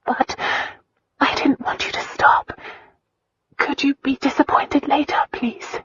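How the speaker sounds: high, breathy pleading; tearful plea. A narrator's reading. Christina pleads with Lion, high and tearful. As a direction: plead in a fragile half-whisper.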